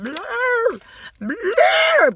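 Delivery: two high mock trunk blasts; elephant trumpeting. Storyteller imitates the elephant the boy expected, trumpeting. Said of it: "elephant is doing just what Bop Bop boy thought".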